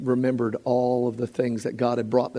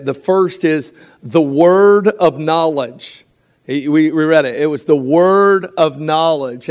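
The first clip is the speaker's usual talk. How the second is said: preaching emphasis; 2 semitones higher, 7 dB louder, slower than usual. Acted. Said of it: "preaching emphasis".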